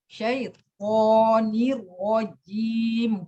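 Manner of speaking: very slow, with "rojim" ending in a long, heavy jim; exaggerated recitation of syaitonir rojim; overdone jim. Shown as a demonstration. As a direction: stretch the jim of rojim too far. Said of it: "Jimnya itu sampai gitu".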